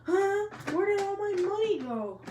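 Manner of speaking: high, startled cry of dismay; high-pitched disbelief at an empty wallet; shocked. Acted. Naruto finds empty wallet.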